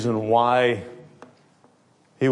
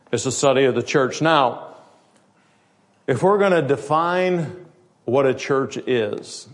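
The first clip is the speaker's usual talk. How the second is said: normal talking voice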